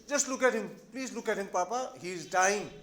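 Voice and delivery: usual speaking voice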